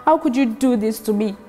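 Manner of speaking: hurt and quiet. Acted. Hurt girlfriend opening.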